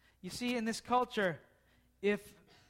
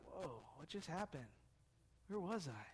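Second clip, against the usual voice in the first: dazed waking; 6 semitones lower, 16 dB quieter than usual. Retold or imitated. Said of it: "He may have been like".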